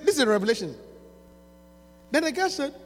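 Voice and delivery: normal talking voice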